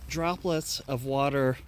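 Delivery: ordinary talk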